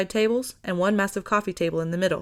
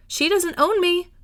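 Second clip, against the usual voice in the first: called back; 10 semitones higher, 4 dB louder, slower than usual. A narrator's reading. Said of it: "Millie called back".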